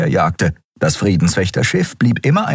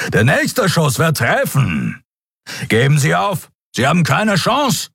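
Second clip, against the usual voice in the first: high, forceful villainous threat; threatening surrender demand; 10 semitones higher than usual. A narrator's reading. Captain threatens the fleeing ship's pilot, forceful and commanding. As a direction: press the enemy hard to surrender.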